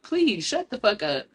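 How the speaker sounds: ordinary delivery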